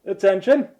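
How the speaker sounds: loud call. Acted. John addressing the whole tavern, loud call. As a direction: call the whole room to order, loudly. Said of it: "he speaks loudly".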